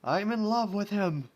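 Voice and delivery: loud, high, blurted declaration of love; dramatic confession. Acted. Rainbow admits her love for a watermelon, loud and dramatic. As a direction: confess it like a soap opera heroine.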